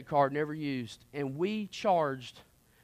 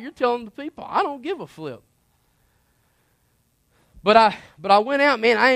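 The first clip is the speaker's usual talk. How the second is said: full preaching; 6 semitones higher, 7 dB louder than usual. Acted. Preacher warning his listeners about worldly company, preaching at full force. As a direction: press the point home like a pulpit preacher.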